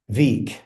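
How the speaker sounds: "Week" begun with a V, as "veek". Shown as a demonstration. Learner practicing with the app, "Week" mispronounced as "veek". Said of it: "my W sounded a little bit like a V".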